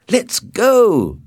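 high, loud call to get going; impatient cry. A narrator's reading. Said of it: "he cried".

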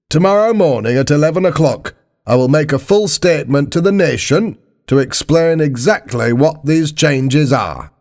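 high-pitched politician addressing the nation; formal political broadcast, pitched high; Prime Minister's address. Acted.

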